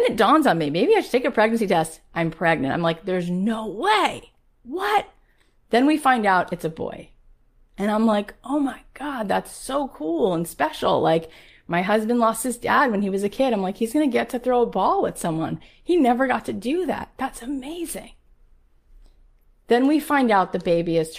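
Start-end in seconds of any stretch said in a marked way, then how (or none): 3.0-5.1 slow doubt, then a high shocked "What?"; disbelieving, pitch jumping up at the end; stunned disbelief. Retold or imitated. Learning she is pregnant at forty, stunned. 8.4-18.1 delighted. Retold or imitated. Retold joy at having boy.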